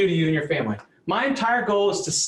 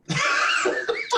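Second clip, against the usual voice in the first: burst of laughter. Real, not acted. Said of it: "Oh my gosh".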